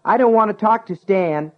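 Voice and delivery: put-on high, loud boyish yell. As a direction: shout it down the stairs. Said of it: "he yelled".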